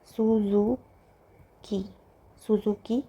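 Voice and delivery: Suzuki stretched out very slowly; drawn-out dictation of the name. Shown as a demonstration. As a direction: say it slowly while writing. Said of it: "की जगह आ जाएगा".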